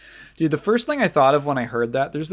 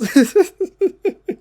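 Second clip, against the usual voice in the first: high-pitched real giggling fit; high, loud cackling laughter; laughing; 8 dB louder than usual. Real, not acted. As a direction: crack up loudly at your own retold joke. Cracks up at retold joke.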